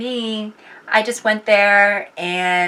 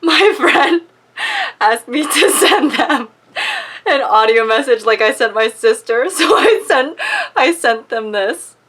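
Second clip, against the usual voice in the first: louder and higher, laughing; laughing while talking; 6 semitones higher, 6 dB louder than usual. Real, not acted. Telling about the friend, laughing through words. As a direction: talk through giggles.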